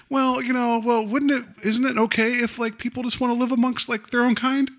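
high-pitched, casual mock motherly voice; offhanded. Imitated mother talking to her son, offhanded and high. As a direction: toss it out lightly, as if harmless. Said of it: "she says it so offhandedly".

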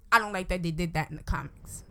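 ordinary talk, nothing marked

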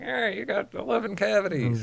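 high, cheery dentist announcement; mock dentist voice. Dentist announcing the cavities, high cheery mock voice. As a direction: chirp the bad news up high.

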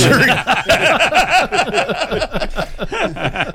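high-pitched laughing fit; long laugh. Real, not acted. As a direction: crack up at length, high. Cracking up at an insinuation.

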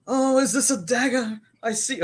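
put-on high, loud theatrical British accent. Mocking a production of Julius Caesar, hammed-up British accent. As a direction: declaim in a mock British stage voice. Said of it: "they all talking British".

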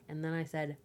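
ordinary talk, nothing marked

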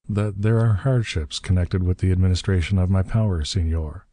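plain reading voice

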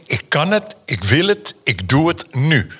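each phrase set apart like a slogan; firm spoken slogan; proclaimed motto. Shown as a demonstration. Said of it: "Die verander ik in".

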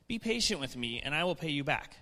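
usual speaking voice

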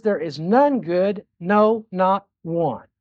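grave, slowed pronouncement; slow solemn verdict. Acted. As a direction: let each word fall slowly like a judgment. Preacher quotes God's verdict.

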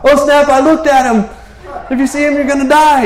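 high, loud, hammed-up terror; mock panic. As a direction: shriek it like a frightened man realizing his doom. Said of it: "roller coaster of in and out of fear".

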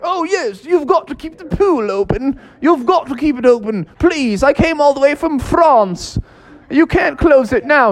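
high pleading put-on voice; pleading disguised frog. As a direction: beg her, still playing Pierre.

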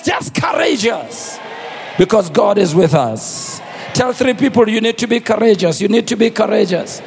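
rally the church, full voice; full-force preaching. Real, not acted.

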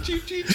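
high cheep cheep imitation; mock cheeping. Recalling the ending scene, high mock cheeping. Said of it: "he was like".

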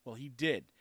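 ordinary talk, nothing marked

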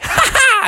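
very high, short cackle; squeaky impish "ha ha"; taunting laugh. A narrator's reading. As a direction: laugh like a cheeky little bear. Junior laughs.

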